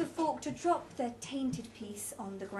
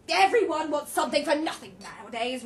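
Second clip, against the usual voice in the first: loud, higher complaint; indignant, raised voice; loud grumbling; 4 semitones higher, 9 dB louder than usual. A narrator's reading. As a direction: complain loudly to the table. Roger grumbles about beggars.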